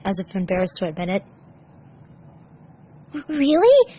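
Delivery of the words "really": hopeful. A narrator's reading. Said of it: "Tails whispered hopefully".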